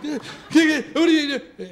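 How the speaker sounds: high, garbled flustered yelp; mock overwhelmed panic. Lifeguard facing a packed pool of kids, mock panic.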